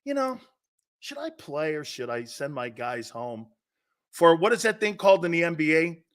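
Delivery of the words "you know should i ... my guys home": much softer pondering; imagined musing. Retold or imitated. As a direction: wonder it aloud, hushed and offhand. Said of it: "And you're like".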